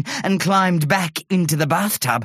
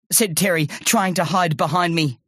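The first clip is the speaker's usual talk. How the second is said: ordinary delivery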